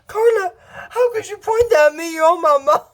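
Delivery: high, wailing mock plea; hammed-up wounded mother. Mother faces daughter's weapon, hammed-up high wail. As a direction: overplay a mother's hurt outcry.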